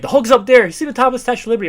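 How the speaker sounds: high, loud, hurried yell; acted yelling. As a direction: call out the alarm in a fast shout. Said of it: "one guy's yelling at".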